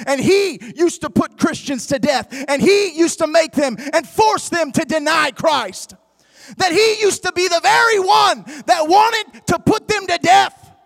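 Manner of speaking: rising from firm to loud preaching; preaching building up. Acted. Preacher to church on Paul, building to loud preaching. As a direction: build the sermon line to a shout.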